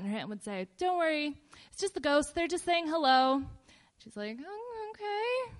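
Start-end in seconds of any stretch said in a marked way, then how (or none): 0.8-3.4 lightly voiced aunt, higher and breezy; reassuring aunt. Aunt soothing her niece about the noisy house, mild reassurance. 4.4-5.5 much higher, hesitant little reply; uncertain acceptance. Retold or imitated. Niece answering her aunt's ghost explanation, uneasy mock acceptance.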